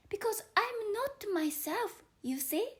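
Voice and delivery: young Alice explaining herself to the caterpillar, lightly voiced.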